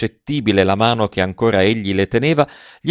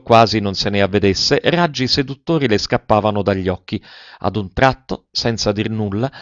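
plain reading voice

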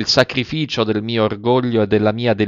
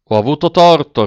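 louder and higher, irked admission; rather irked; 5 semitones higher, 6 dB louder than usual. Acted. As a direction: snap out the apology, annoyed. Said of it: "alquanto indispettito".

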